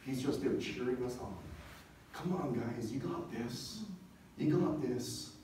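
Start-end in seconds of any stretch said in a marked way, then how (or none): none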